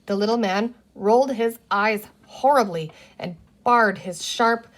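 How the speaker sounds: usual speaking voice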